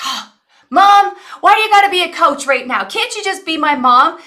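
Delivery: put-on high, loud exasperated teen whine; whiny teen daughter. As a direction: whine like a fed-up teenager. Daughter voiced, annoyed whine.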